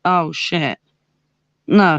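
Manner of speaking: ordinary delivery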